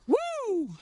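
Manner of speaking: very high cheering woo; joyful whoop. Real, not acted. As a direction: let out a celebratory whoop. Back on the canal, celebrating.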